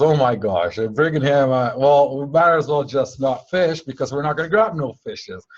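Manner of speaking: loud, fed-up grumble in a put-on voice; loud frustrated friend giving up on fishing; exasperated complaint. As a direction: complain loudly that the fishing is hopeless.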